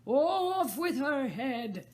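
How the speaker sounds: loud, slow raging shout; the furious Queen shouts orders over the game. A narrator's reading. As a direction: stamp and bellow. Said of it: "stamping about and shouting".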